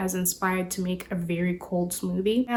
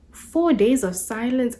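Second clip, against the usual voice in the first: ordinary talk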